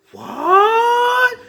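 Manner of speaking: high, long drawn-out "what"; hammed-up astonishment, pitched high; shocked disbelief. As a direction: stretch it upward in mock shock. Acting out shocked reaction to news.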